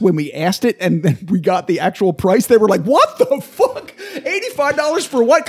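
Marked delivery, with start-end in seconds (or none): 2.8-5.5 shocked disbelief. Retold or imitated. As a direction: gasp out the price in disbelief. People shocked at whiskey price.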